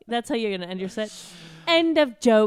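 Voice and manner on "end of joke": put-on Australian accent. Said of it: "Australian stand-up character".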